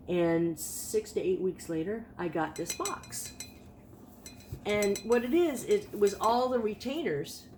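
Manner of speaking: ordinary talk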